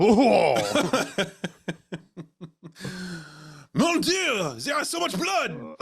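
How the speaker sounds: very high hammy French shout; French accent. Acted. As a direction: shriek it in a cartoon French accent. Continues French mock voice.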